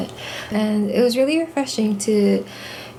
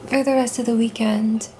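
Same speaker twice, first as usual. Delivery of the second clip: ordinary talk, nothing marked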